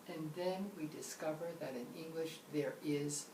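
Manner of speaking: usual speaking voice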